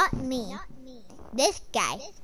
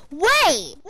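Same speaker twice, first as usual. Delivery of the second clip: shout; 5 semitones higher, 9 dB louder than usual. Real, not acted. Sudden call.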